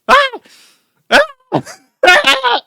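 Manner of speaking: very high, loud mock shriek; short comic screams of fright; imitated startled scream. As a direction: yelp as if jump-scared, hammed up. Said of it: "His reaction".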